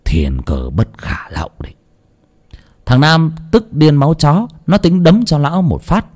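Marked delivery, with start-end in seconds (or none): 0.1-1.7 low, unvoiced, secretive; a cryptic whispered refusal; curt whisper. A narrator's reading. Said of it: "nói một câu cụt lộn". (thì thầm cụt lủn)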